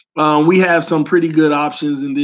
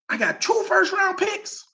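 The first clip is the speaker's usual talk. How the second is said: excited; 12 semitones higher, 6 dB quieter than usual. Real, not acted. Imagined trade haul.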